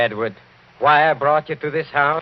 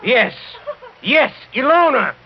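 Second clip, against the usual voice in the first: loud, high exultant reveal; triumphant shout; 12 semitones higher, 5 dB louder than usual. Acted. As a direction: hammer the name home. Count triumphantly names culprit.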